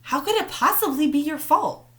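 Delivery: mother caught off guard, aghast; stunned mom protesting; shocked. A narrator's reading. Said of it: "Mom sounded shocked".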